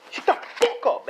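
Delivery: high, strained yell; hammed-up high-pitched retort; mock angry outburst. Deku to Bakugo as he stomps him, mock angry yell.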